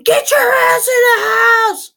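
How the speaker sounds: high, loud, slow yell; screaming mom. Retold or imitated. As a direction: bellow the kids inside. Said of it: "I'm screaming".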